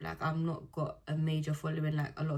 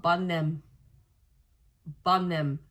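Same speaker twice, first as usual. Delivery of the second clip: louder, punchy dismissal; loud blunt advice; 7 dB louder, slower than usual. Real, not acted. Telling a heartbroken viewer to drop him, loudly. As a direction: stamp it out firmly.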